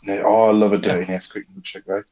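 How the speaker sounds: ordinary delivery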